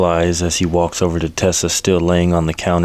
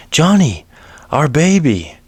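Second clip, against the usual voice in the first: shouting; 9 semitones higher than usual. A narrator's reading. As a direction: call out her husband's name in sudden panic. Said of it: "she shouts".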